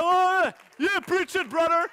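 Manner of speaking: hyped-up churchgoer yelling approval; excited shout. Retold or imitated. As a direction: cheer the preacher from the back. Excited worshipper shouting in back.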